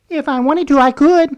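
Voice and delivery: high-pitched mimicry of her voice; high mock cohost voice; imitating female cohost. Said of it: "could you talk like me if you wanted to".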